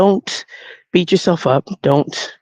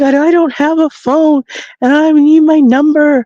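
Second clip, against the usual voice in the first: high, louder mock-distressed voice; upset young woman; 9 semitones higher, 4 dB louder than usual. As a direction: plead in an imitated young woman's voice. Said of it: "this is how she talks".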